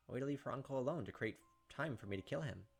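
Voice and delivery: normal talking voice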